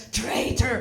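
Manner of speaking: mock accusing call, held back in volume; first, shorter mock yell of an angry soldier; imitated soldier's scream. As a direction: call it after someone fleeing. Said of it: "Russian soldier screaming in my back".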